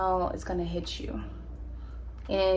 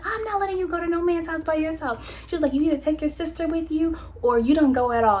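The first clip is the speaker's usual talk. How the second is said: a hint of a strict mother, higher; lightly voiced firm mom laying down the law; mock-stern parent ultimatum; 9 semitones higher than usual. Mom forbids going alone.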